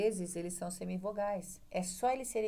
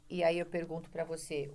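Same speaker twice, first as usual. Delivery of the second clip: ordinary talk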